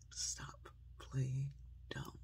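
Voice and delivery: soft, slow, word by word; hushed, deliberate appeal; gentle admonition. Real, not acted. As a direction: close quietly, spacing each word. Soft closing appeal, same phrase.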